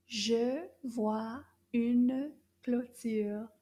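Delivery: very slow, careful sentence; slow French model. Shown as a demonstration. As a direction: model it word by word. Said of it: "Toute la phrase".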